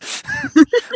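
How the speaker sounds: loud, high laugh that bursts out; big burst of laughter. Real, not acted. Laughing loud at her own story to her friend. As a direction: crack up at your own story.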